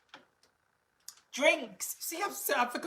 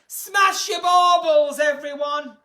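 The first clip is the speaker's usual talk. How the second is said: loud, slow call to the viewers; rally everyone with a loud slow cry; rallying shout; 6 semitones higher, 11 dB louder, slower than usual. Real, not acted. Urging viewers to smash baubles.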